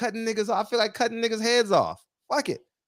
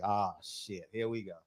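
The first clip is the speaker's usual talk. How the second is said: low, hushed oh-no groan, retold; hushed retold "here we go" dread; dread-filled muttered reaction; 9 semitones lower, 10 dB quieter than usual. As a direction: mutter it like trouble is coming. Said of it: "I'm like".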